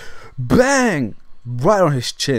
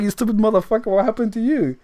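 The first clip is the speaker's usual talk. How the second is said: higher, scolding; teen scolding the friend who came late, higher; mock angry; 9 semitones higher than usual. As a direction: chew out the friend.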